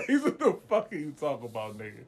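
laughing, incredulous question. Real, not acted. Asking a rambling friend to explain, laughing. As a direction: crack up while asking.

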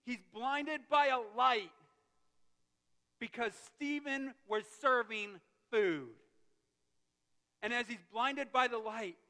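loud, emphatic storytelling from the pulpit; forceful preacher building a moment; impassioned preaching. Real, not acted. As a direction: hammer the key words. Preaches Saul's blinding at full force.

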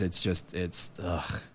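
low, quiet and worn out; real weariness, sinking softly; sounding tired. Real, not acted. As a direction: sigh it out, drained. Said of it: "I apologize for sounding tired".

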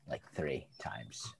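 whispered slowly under breath. Real, not acted. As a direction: drop to a slow whisper. Whispered aside about mom.

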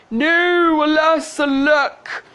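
loud, high theatrical lament; high, hammed-up cry of dismay; anguished cry. A narrator's reading. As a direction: wail it like a melodramatic prince. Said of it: "The prince cried".

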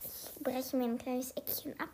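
soft unvoiced whisper. Real, not acted. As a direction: whisper it to yourself. Quiet aside while tasting.

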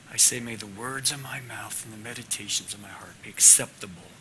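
soft, hushed prayer voice sinking to a whisper; quiet prayer. Acted. Preacher leading the class in prayer, hushed and humble. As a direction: pray humbly, close to the mic.

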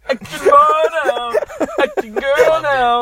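mock shout. Mock movie cry.

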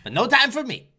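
high, loud hammed-up whine; mock whiny complaint. Friend parodies a neglected date, high whiny voice.

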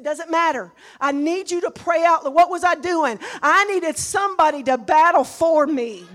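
loud preaching. Acted. Preaching at full force.

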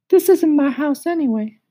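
very high, sing-song cricket asking who's there; high musical cricket. Acted. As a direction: squeak it up high like a tiny cricket. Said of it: "He had a high musical voice".

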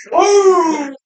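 high, loud triumphant cry; imitated whoop. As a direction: let out a hunter's victory whoop. Retelling hunter's whoop.

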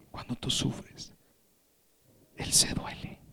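slow, tender whisper; whispered with reverence; hushed. Real, not acted. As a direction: drop into a soft whisper to move the listeners. Tender sermon point on God's empathy.

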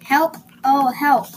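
lightly acted chick's alarm; calling for help. A narrator's reading. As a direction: call out, mildly panicked. Frightened chick calls for help.

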